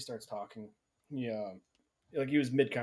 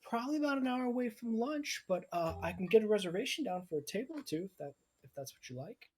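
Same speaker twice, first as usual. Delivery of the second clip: much higher, sweet hostess voice; cheery high voice greeting guests at the door; half-elf maiden; 12 semitones higher than usual. Acted. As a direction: lift way up into a chirpy maiden. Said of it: "She goes".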